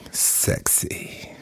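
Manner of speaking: hushed, whispered mock swoon; soft, airy comic come-on; mock-seductive. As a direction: whisper it as if smitten.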